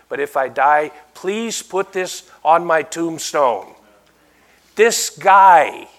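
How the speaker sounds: loud, emphatic pulpit declaration; impassioned preaching. Real, not acted. Preacher telling people what to put on his tombstone, loud and impassioned. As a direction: drive it home to the congregation.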